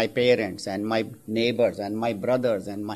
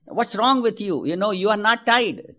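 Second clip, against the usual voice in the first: exasperated yell; 10 semitones higher, 5 dB louder than usual. Acted. Man yelling at the unmoving camel.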